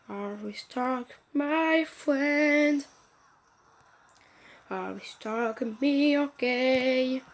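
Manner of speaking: drawn-out bad French-accented English; rotten English accent. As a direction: stretch out terrible English, slowly. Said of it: "J'ai un accent pourri". (anglais à l'accent pourri, traînant)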